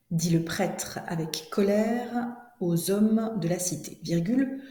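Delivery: drawn-out, carefully separated phrase groups; slow dictation. Shown as a demonstration. Teacher rereads for pupils writing, slow deliberate dictation. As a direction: stretch each phrase so writers can keep up. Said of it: "je commence la dictée".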